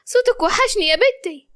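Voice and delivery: high-pitched motherly delight; happy. A narrator's reading. Mother hears her daughter's voice at last, happy. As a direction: rejoice at hearing her, pitched well up. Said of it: "ريحانة قالت وهي فرحانة".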